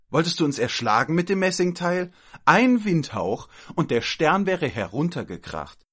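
high, loud outraged scolding; indignant. Acted. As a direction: accuse the child in high outrage. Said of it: "rief sie empört". (laut und empört)

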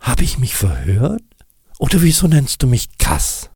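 höher, verdutzt fragend; Kass, verwunderter Schneemann. Acted. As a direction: frag verblüfft nach dem Spitznamen. Kass's character voice. (Kass fragt Nick verdutzt)

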